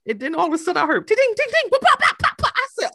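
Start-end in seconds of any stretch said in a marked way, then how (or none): 1.1-2.5 high popping hail noises; mimicked hail pings. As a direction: tap out the hail like gunfire. Imitating hail hitting.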